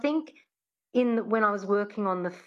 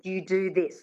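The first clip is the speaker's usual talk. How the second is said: ordinary delivery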